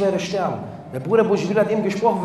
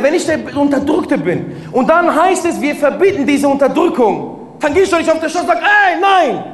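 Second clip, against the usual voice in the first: loud, higher, insistent sermon delivery; full-force preaching; 7 semitones higher, 6 dB louder than usual. Acted. Preacher warning the brothers and sisters, at full force. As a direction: hammer it home to the congregation.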